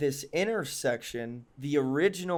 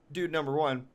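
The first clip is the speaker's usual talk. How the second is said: ordinary talk, nothing marked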